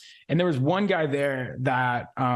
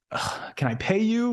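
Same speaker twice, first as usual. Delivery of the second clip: mock whine, pitched higher and slowed; whiny plea; 7 semitones higher than usual. Broke rookie begs the top closer for help, whiny plea. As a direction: beg like a desperate broke rookie. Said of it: "And I was like".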